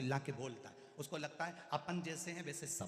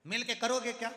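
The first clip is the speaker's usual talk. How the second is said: grandson shouting; 7 semitones higher, 8 dB louder than usual. Acted. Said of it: "नाना चिल्ला कर बोला".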